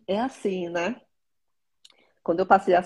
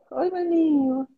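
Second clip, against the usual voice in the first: cheery greeting; 9 semitones higher, slower than usual. Real, not acted. Greeting little brother Eduardo.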